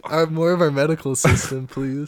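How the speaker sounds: loud, high mock plea; mock pleading shout. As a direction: beg them loudly, half-joking.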